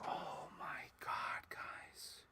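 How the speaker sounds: slow breathless whisper; awed whisper. Real, not acted. Finding a favorite Obi-Wan figure, slow awed whisper. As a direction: hush it like you found treasure.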